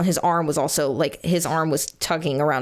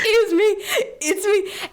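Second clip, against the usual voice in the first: laughing too hard to talk; 14 semitones higher, much slower than usual. Real, not acted. Retelling her breakdown to the co-host, real helpless laughter. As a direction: barely get the words out for laughing. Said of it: "I can't even talk".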